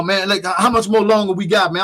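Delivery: lightly voiced antsy guest; imitating impatient guest. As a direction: plead to wrap it up. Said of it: "He was like".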